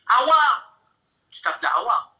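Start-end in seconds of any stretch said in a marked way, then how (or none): 0.1-0.8 raised voice calling a name. Retold or imitated. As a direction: call her name across the flat. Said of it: "Je dis".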